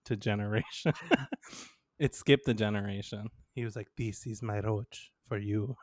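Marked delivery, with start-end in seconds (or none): 4.0-5.7 soft mock foreign accent, halting; grandpa's foreign-accented English. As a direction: quietly imitate a grandpa's broken, accented English. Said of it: "He doesn't speak English".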